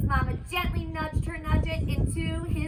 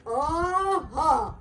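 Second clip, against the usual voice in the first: trembling agreement. Acted.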